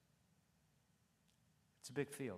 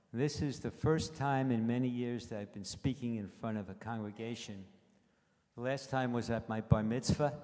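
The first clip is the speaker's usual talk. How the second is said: louder into the mic; 8 dB louder than usual. Real, not acted. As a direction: speak up so the whole room hears. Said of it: "is that more better?".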